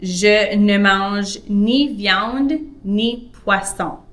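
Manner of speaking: slow, careful French example; deliberate teacher read; slow model sentence. Shown as a demonstration. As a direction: say it slowly for learners. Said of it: "in French".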